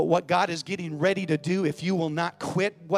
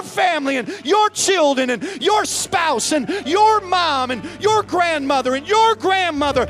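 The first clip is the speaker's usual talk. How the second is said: loud, rising preacher cry over the music; preaching at full force; 12 semitones higher, 9 dB louder than usual. Acted. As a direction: build it and shout it to the back row. Preacher building to climax.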